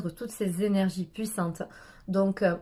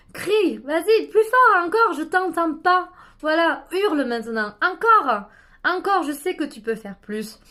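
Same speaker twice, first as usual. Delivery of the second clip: higher and louder, urging harder; shouting encouragement; 8 semitones higher, 6 dB louder than usual. Shown as a demonstration. Guide urging listeners to yell louder, shouting. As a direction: push them to scream louder. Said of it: "vous allez m'entendre hurler".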